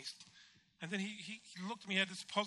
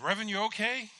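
raised-pitch nurse's checking question; concerned nurse; 6 semitones higher, 4 dB louder than usual. Retold or imitated. Nurse waking the patient, lightly voiced concerned call. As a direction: call out to rouse a sleeper.

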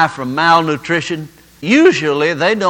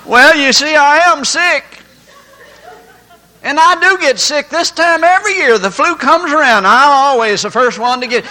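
higher, louder mock protest; mock self-justifying; 7 semitones higher, 4 dB louder than usual. Believer defending his yearly flu, mock insistent. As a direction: imitate someone insisting on their illness. Imitates people confessing sickness.

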